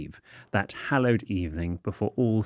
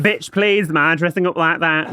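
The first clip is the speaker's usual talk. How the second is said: high, loud sassy put-on voice; sassy diva voice; 7 semitones higher, 5 dB louder than usual. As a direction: camp it up with attitude.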